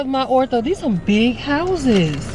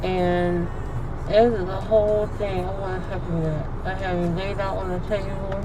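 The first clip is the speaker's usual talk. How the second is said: soft, woozy post-anesthesia mumbling; groggy after surgery; 6 dB quieter than usual. Real, not acted. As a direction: talk drowsily, still foggy from sedation. Just out of surgery, sedated.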